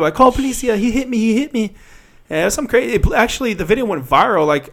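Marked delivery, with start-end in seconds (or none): 0.1-1.8 high-pitched mock cry for help; imitated yelling. Passenger to people outside, mock yell out the window. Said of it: "she's yelling out the window".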